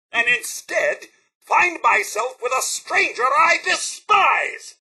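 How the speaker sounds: high, slow, theatrical outrage; indignant outrage. Acted. Butler rebuking a friend he admired, high outrage. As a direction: build to a scornful cry. Butler disowns companion in disgust.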